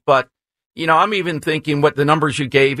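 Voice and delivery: ordinary talk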